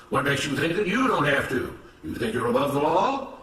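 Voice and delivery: firm, emphatic denunciation; accusing, righteous overseer. Acted. Mister Smith blaming Samuel before the other workers, accusing. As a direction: lay the blame with self-righteous conviction.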